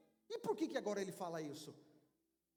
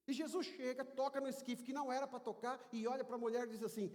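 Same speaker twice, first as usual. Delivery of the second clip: normal talking voice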